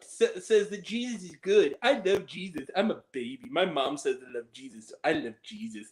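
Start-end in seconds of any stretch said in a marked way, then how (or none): 2.7-5.9 low mock baby. Mock baby catchphrase, dropping low.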